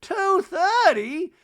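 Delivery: high, loud, slow mock shock; sky-high drawn-out outrage; Reba voice. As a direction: squawk it like an appalled mother. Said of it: "Reba in scene".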